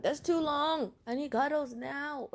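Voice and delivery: high, loud mock whine; whining boyfriend. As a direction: imitate a needy boyfriend complaining. Boyfriend whines about the wait.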